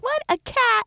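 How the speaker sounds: high-pitched puzzled exclamation; mock surprise. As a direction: play baffled at hearing a cat. Acts out fooled listeners at door.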